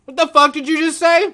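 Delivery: high, loud fake indignation; mock outrage. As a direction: act shocked and offended.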